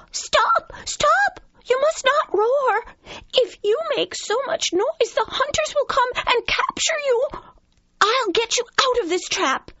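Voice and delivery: very high squeaky mouse voice; urgent mouse. Acted. Mouse warning the trapped lion of hunters, urgent squeak.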